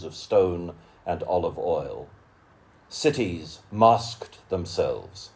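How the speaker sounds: measured, rhythmic poem recital with lingering pauses; poetry reading. Acted. Reading a friend's poem aloud to an audience, solemn recital. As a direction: let each image land, unhurried and solemn.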